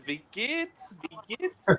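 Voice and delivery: high, soft mock German greeting; fake German accent. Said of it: "put it on that fake German accent".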